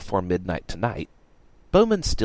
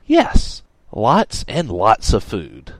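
loud, emphatic, drawn-out assurance; insistent; 6 dB louder, much slower than usual. A narrator's reading. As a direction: insist on it, stretching the words. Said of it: "Radix insisted".

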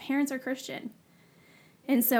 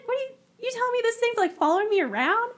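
much higher, fast, alarmed question; retold alarmed disbelief; 13 semitones higher, 5 dB louder, much faster than usual. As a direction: rush it out in shocked disbelief. Told darkness follows her.

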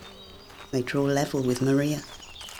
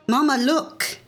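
put-on child calling out; 9 semitones higher than usual. A narrator's reading. Child hammering on train window.

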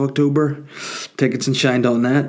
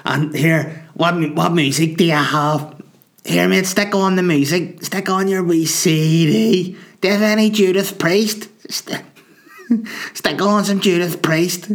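higher mock pub-drunk voice; mock drunk demanding tunes, higher; drunk punter; 6 semitones higher than usual. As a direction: shout for the music like a drunk. Drunk punter asking for music.

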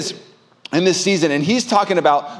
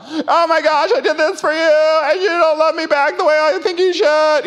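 high, hammed-up whiny wail; shrill mock meltdown, pitched way up; mock freak-out; 11 semitones higher than usual. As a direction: play the needy husband losing it. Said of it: "freak out".

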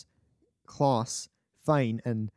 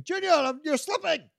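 high-pitched mock panic; mock shouting; 13 semitones higher than usual. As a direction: cry out high without real volume. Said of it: "It's not shouting".

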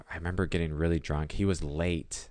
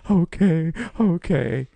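crying; 12 semitones higher, 8 dB louder, slower than usual. Retold or imitated. Drunk crying agreeing.

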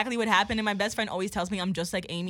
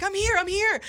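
put-on eager old man; 11 semitones higher, 3 dB louder than usual. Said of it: "He's like".

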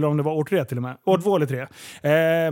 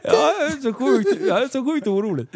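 mock worried whiner; 6 semitones higher than usual. Mocking a worried critic.

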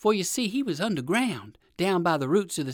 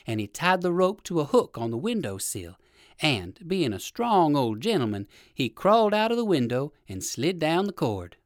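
ordinary delivery

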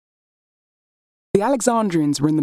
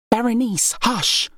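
urgent hush; 8 semitones higher, slower than usual. Acted. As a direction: hush your sister before anyone hears. Cleopatra hushes her sister.

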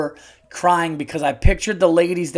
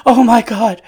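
mock gasp, higher and much louder; startled woman; 6 semitones higher, 10 dB louder than usual. Imitating the blonde woman jumping at the sneeze. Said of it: "she goes".

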